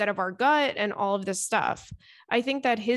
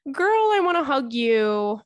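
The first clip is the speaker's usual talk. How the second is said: tender aw; 5 semitones higher, 4 dB louder, slower than usual. Real, not acted. Host to heartbroken letter writer, tender and slow. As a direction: comfort the letter writer gently.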